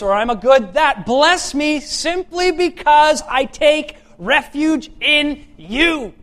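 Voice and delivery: loud, high-pitched preaching, slowing on the last plea; fiery preaching. Acted. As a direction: thunder it at the congregation. Preaching at full force.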